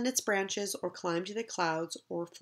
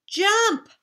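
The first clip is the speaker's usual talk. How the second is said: high, louder cry; excited call; 11 semitones higher, 7 dB louder than usual. Acted. Urging brother in tree.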